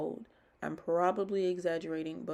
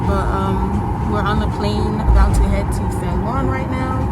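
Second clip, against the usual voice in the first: muffled talking behind a face mask; through a mask; 4 semitones higher, 19 dB louder than usual. Real, not acted. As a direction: speak with the mask over your mouth. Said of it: "You can't hear me through my mask".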